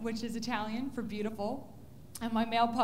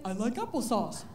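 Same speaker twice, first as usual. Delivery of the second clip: dopey dog voice; 4 semitones lower than usual. Retold or imitated. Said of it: "she'd probably say".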